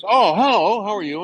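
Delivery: lightly voiced high, friendly hello; a hint of a cheery big guy saying hi; friend Ken's greeting. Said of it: "And he goes".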